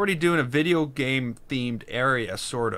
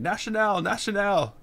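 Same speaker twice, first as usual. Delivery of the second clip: higher-pitched mock yell; retold yelling; 7 semitones higher than usual. French police challenging tourists in the subway, mock yelling. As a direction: imitate a yelled challenge without real volume. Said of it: "machine guns in the subway yelling".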